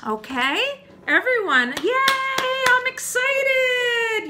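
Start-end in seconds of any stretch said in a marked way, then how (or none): 1.8-4.3 very high, drawn-out squeal of a cheer; big, squealing excitement; excited. Shown as a demonstration. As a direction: cheer it out for children to copy. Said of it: "You do your excited face".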